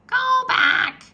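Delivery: high, loud squawking parrot. A narrator's reading. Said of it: "she squawked".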